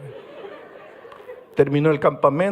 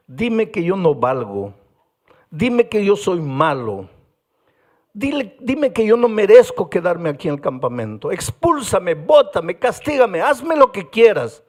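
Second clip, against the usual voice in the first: building from pleading to loud, high outcry; boy pleading with pastor, escalating desperation; desperate plea; 5 semitones higher, 6 dB louder than usual. Retold or imitated. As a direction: beg for punishment, rising to a cry. Boy begging to be punished.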